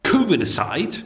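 usual speaking voice

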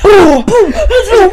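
high, loud booming imitation; wave guy imitation. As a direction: boom like crashing waves, hammed-up. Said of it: "that guy who's doing the waves".

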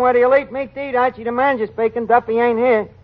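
a touch loud answering the phone; loud phone greeting. Acted. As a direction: bark a cheerful hello down the line. Answering tavern phone loudly.

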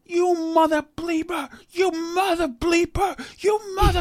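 high-pitched, drawn-out parody of a raging driver; imitating furious driver. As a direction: squeal the curses out like an enraged man. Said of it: "all I can hear is he's going".

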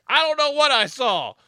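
high, loud mock outburst; imitated cranky yell. Old worker answers riders, imitated cranky yell. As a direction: snap it loudly like a grouchy old man. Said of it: "He's like".

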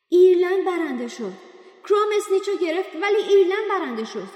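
high, loud stunned announcement; shouting announcer. Acted. Bagman announcing Ireland's win to the crowd, high loud shout. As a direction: blast the result to the crowd. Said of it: "بگمن ... فریاد زد".

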